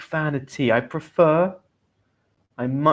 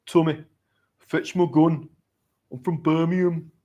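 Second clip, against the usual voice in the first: higher, put-on Birmingham accent; Peaky Blinders accent; 4 semitones higher than usual. Shown as a demonstration. Teacher imitating TV gangsters in a Birmingham accent. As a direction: do a Brummie gangster impression. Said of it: "that's my best Peaky Blinders accent".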